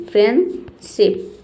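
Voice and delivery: फ्रेंडशिप slowly as "fren... ship", no D; silent D. Shown as a demonstration. Teacher models the word for learners, slow silent D. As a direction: split फ्रेंडशिप in two, leaving the D out.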